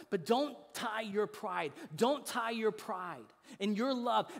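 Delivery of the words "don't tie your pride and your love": ordinary delivery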